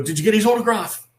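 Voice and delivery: high, loud, urgent question; imitated eager kid. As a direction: blurt it like a star-mad child. Retells his eager childhood question.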